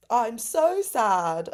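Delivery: high, loud, drawn-out proud declaration; said with chest. Retold or imitated. Kids who then have the biggest giggles, gleeful proclamation. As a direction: proclaim it slowly with full gusto. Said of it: "saying with chest".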